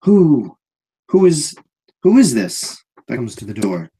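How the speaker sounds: big guard challenging who came in; wary guard. Acted. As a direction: call out to a stranger at the door. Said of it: "looks across the room and goes".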